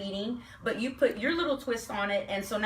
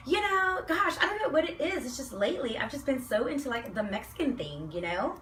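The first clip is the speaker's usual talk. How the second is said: put-on Valley Girl voice; 3 semitones higher than usual. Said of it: "With the Valley Girl voice".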